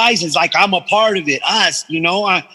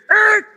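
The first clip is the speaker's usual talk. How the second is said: imitated tyre skid. Van skidding, imitated.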